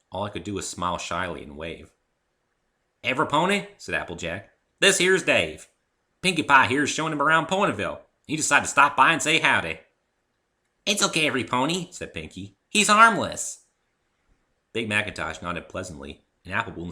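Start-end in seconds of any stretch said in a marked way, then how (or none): 3.0-3.7 high, loud country mare twang; Applejack voice. A narrator's reading. 4.8-9.8 high, loud country mare twang; Applejack voice. A narrator's reading. 10.9-11.9 very high, loud bubbly pony; excited squeaky chatter; Pinkie Pie voice. A narrator's reading. 12.7-13.7 very high, loud bubbly pony; excited squeaky chatter; Pinkie Pie voice. A narrator's reading.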